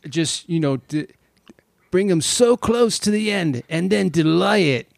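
attempted British accent, like [name]. Film fan imagining [name] directing a film, put-on British accent. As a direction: do a rough amateur [name]-style British accent. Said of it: "my attempt at a [name] accent".

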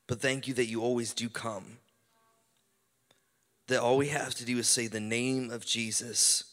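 slow, hushed praying voice. Acted. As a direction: pray it out gently, slowly. Leads a prayer over music.

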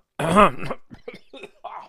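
real throaty coughing fit; loud cough. Real, not acted. Host coughing after lunch, real loud cough. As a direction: cough hard into the mic.

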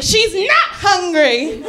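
slow, drawn-out bossy answer; mock-bossy cutting in for her sister; bossy little sister.

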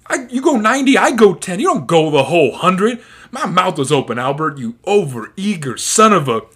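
loud, high-pitched telling-off; high angry scolding. Retold or imitated. Hitch scolding Albert after the kiss, high loud rant. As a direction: yell at the overeager student.

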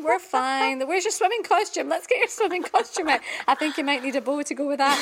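high, bright fake-cheerful chirp; forced cheerfulness. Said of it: "and you're like".

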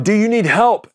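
high, lightly mocked hail; calling out. Bigger ship hails the stranded man, calling out. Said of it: "they call out to him".

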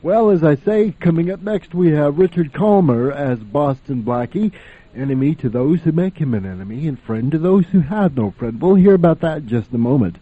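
normal talking voice

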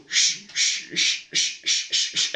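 whispered shushing, rising; grandpa shushing. Retold or imitated. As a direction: shush like sneaking past a window. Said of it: "and he'd go".

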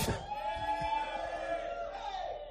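high shrieking laugh of joy; joyful laugh. Real, not acted. Preacher laughing for joy at the son's welcome.

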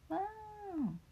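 a high, thin mock wail; storybook wail. Little monster wails at midnight, a high mock wail. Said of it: "大きな鳴き声です".

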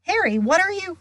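high, startled and urgent; alarmed question. A narrator's reading. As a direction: cut in, startled and worried. Alarmed friend interrupting.

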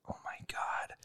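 whispered oh my God; embarrassed whisper. Retold or imitated. After knocking the wine glass over, whispered. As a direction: breathe it out mortified.

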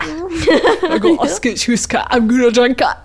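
put-on silly Scottish accent done on request. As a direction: play a giggling drunk Scot. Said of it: "please do your Scottish accent".

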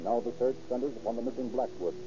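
ordinary talk

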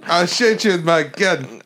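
slow, exaggerated South African accent; hammy mock South African; attempted South African accent. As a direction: drag it out in a badly faked South African accent. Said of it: "Is that not South African?".

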